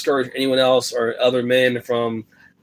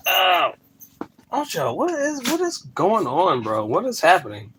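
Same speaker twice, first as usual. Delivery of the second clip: high, slowed disbelief at the dog; baffled exclaim; 8 semitones higher than usual. Real, not acted. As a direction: stretch out the bafflement, pitched up. Dog acting crazy.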